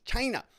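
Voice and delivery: mimicked "China", pitched high with a sharp fall; Donald Trump. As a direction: punch out "China" high, as an impression. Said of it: "he says it like that".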